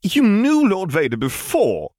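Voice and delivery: put-on high, slow little boy; high curious toddler. A narrator's reading. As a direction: ask like a curious small child. Child Ben asks.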